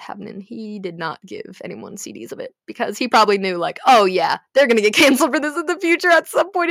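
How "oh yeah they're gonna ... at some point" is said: higher, louder mock teacher voice; imitating worried teacher. Teacher fretting about getting canceled, mock worried voice. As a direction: play the nervous teacher. Teacher's imagined worry quoted.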